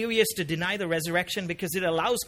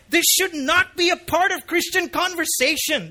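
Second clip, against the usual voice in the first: high, loud, appalled protest; shocked surprise; 9 semitones higher, 6 dB louder than usual. Acted. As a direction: insist on it with raised-voice astonishment. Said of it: "it's an expression of surprise".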